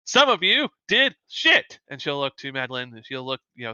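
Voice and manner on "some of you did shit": high, slow, punched-out scorn; scathing contempt. Acted. Instructor to the failing students, scathing contempt. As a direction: drag out the insult, pitch leaping up. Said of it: "She's like".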